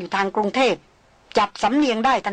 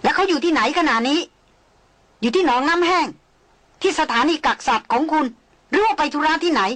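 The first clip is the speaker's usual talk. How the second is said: higher, questions fired off urgently; rapid-fire questions; 6 semitones higher than usual. Acted. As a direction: shoot the questions like a machine gun. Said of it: "ยิงคำถามเร็วปรือราวกับปืนกล". (ยิงคำถามรัวเหมือนปืนกล)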